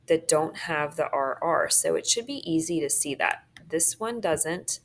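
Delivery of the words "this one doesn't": ordinary delivery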